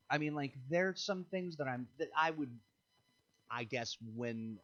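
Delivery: normal talking voice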